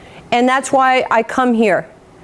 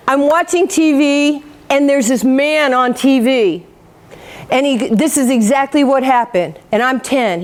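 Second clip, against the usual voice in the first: normal talking voice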